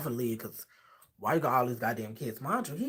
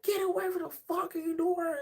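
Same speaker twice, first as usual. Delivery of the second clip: angry father yell. Retold or imitated. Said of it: "he get loud".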